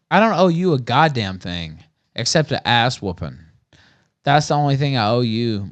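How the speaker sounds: ordinary talk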